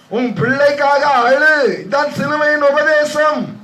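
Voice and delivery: high, sustained proclamation; impassioned preaching. Real, not acted. Preacher exhorting congregation. (உணர்ச்சியான உயர்ந்த குரல் பிரசங்கம்)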